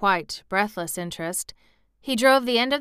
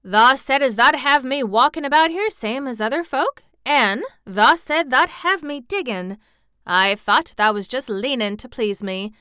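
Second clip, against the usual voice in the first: put-on loud, higher triumphant boy in Yorkshire dialect; exultant Yorkshire; 5 semitones higher, 8 dB louder than usual. A narrator's reading. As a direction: crow proudly in best Yorkshire. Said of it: "spoke exultantly to Dickon in his best Yorkshire".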